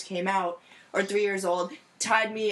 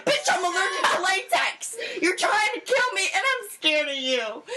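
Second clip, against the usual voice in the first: retold outburst; 10 semitones higher than usual. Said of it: "and I'm like".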